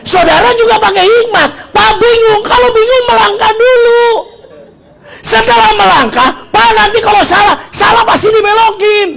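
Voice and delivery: loud, high-pitched exhortation to the congregation; full-force preaching. Acted. Preacher urging confused listeners to step out first, full-force preaching.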